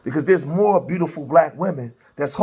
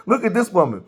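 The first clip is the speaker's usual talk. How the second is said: ordinary talk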